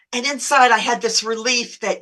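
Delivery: ordinary delivery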